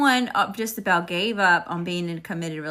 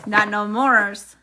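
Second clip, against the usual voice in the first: sassy drawl; 6 dB louder, much slower than usual. Real, not acted. Sassy aside about ex.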